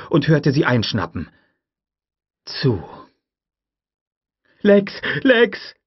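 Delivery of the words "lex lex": loud, high urgent shout. A narrator's reading. Tim calling his sister for help, shouting. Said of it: "schrie Tim".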